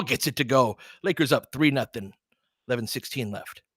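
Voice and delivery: quick running game call, rising on big plays; casual sports commentator calling each possession; live play-by-play. Acted.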